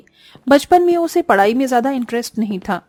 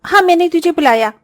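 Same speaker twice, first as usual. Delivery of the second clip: louder, higher and commanding; bossy sister-in-law; 6 semitones higher, 4 dB louder than usual. Acted. Sister-in-law orders Disha to press her head, bossy and louder.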